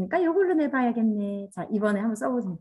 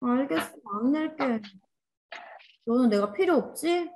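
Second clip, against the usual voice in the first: sulking energy; 2 semitones higher than usual. Pretend. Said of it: "내 에너지가 어떻게 하냐면".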